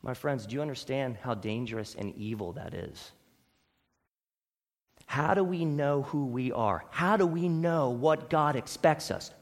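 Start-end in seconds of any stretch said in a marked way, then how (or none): none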